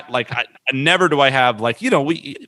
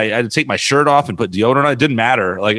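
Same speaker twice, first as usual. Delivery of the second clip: normal talking voice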